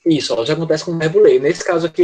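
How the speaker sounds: usual speaking voice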